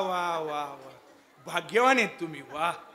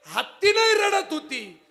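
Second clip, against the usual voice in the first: rising emotional cry; 4 semitones higher, 4 dB louder than usual. Acted. Kirtan preacher tells tearful parting.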